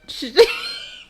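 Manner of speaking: high, loud laughing shriek; giddy shout of a laugh. Real, not acted. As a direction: cackle at the prank. Laughs at prank raid idea.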